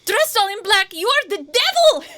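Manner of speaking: put-on rising, furious Russian accent accusation. Pavlova accuses the intruder in a shrill Russian accent. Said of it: "my Russian accent is very rusty".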